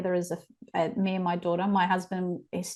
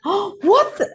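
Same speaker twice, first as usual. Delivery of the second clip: high shocked "Oh, what the", pitched way up; shocked gasp; 12 semitones higher, 5 dB louder than usual. Retold or imitated. Mum reacting to her eight-year-old, high shocked gasp. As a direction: gasp it out in disbelief.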